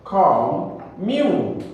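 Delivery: commune broken into two separate beats, come, mew; split into syllables. Shown as a demonstration. Teacher reminding the class of two syllables, come and mew split apart. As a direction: say come, then mew, with a gap between. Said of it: "Two syllables".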